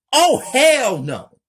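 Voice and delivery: very high, very loud frightened shriek; scared. Acted. Kelly seeing the dead officers, terrified shriek. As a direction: scream it at the sight of dead police.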